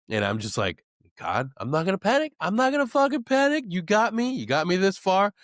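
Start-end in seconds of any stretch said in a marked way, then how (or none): 1.2-5.3 high, strained voice, a little louder; talking himself out of panic, voice pitched way up; fighting off panic. Retold or imitated. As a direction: squeak out a pep talk through rising panic. Retold self-pep talk fighting panic.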